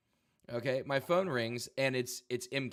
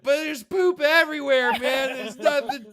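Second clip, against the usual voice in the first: freaking out; 12 dB louder than usual. Retold or imitated. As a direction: wail it like a drunk friend seeing disaster. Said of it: "He's like".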